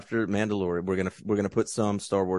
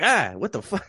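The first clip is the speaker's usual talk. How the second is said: high startled cry; shocked yelp; 9 semitones higher, 4 dB louder than usual. Retold or imitated. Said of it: "they're gonna be like".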